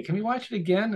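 put-on high, eager child voice begging; little daughter. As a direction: imitate a small girl pleading to watch. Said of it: "And then the next day".

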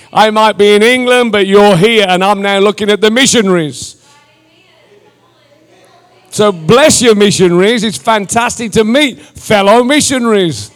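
loud, punchy commissioning; rousing preaching. Real, not acted. Preacher sends congregation out as missionaries, loud rousing preaching. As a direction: fire up the congregation with full-voiced charges.

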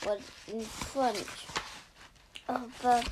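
plain reading voice

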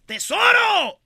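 high, loud excited yell; high-pitched treasure shout. Retold or imitated.

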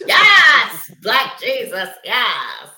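amen-style hollering; loud preacher's yes; church shout. Real, not acted.

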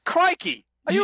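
a high, loud hammed-up Australian "Crikey!"; mock Australian exclamation. Co-host hams up an Australian accent, high and loud.

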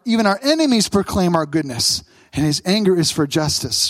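ordinary talk

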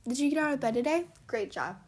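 normal talking voice

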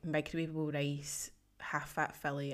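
ordinary talk, nothing marked

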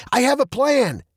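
high, emphatic declaration; insistent dad. A narrator's reading. Dad announces his plan amid danger, high and urgent.

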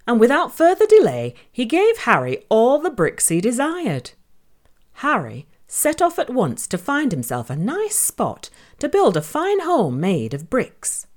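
usual speaking voice

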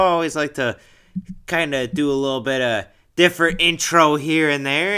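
ordinary talk

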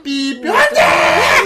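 high, loud, drawn-out mock despair; dramatic cry. Acting a patient dying nearby, hammed-up desperate shout. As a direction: beep slowly, then wail in alarm.

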